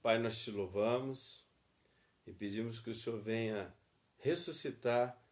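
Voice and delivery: fervent spoken prayer; closing prayer. Acted. Student prays for marriages, fervent prayer. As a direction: pray it over the couples.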